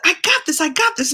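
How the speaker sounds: put-on a kid sure she can do it; excited little girl. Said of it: "I said".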